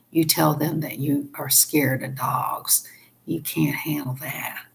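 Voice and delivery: hushed, breathy aside; whispered tip. Real, not acted. Telling viewers a sneaky trick in a hush. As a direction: let them in on a secret.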